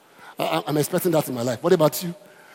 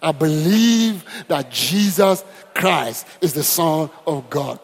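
drawn out and louder; slow solemn confession; 5 dB louder, much slower than usual. Shown as a demonstration. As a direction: confess each word slowly and firmly. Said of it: "I want to confess that one more time".

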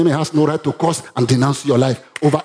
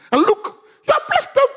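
much higher, outraged complaint; angry Balak. Acted. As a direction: cry out like a betrayed king.

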